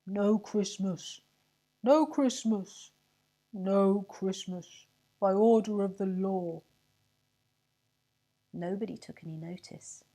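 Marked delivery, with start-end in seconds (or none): 0.1-6.6 slow, loud mournful proclamation; gloomy town crier. A narrator's reading. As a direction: call it out like a sad town crier. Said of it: "called out gloomily".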